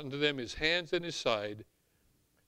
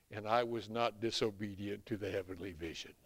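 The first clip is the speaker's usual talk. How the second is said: solemn quiet quote; 8 semitones lower, 8 dB quieter than usual. Retold or imitated. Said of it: "the Apostle Paul says".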